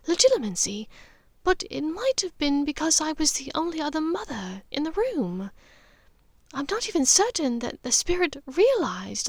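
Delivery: a mother confiding something uncanny, half-whispered; Narcissa's voice. Acted. Narcissa explains further.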